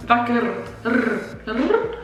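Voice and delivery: usual speaking voice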